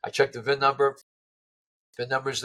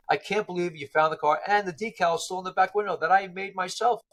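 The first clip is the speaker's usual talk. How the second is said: higher-pitched, amazed quote; blown away; 7 semitones higher than usual. Retold or imitated. Caller amazed the lost car was found, blown away. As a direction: imitate a caller who can hardly believe it. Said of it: "they were blown away".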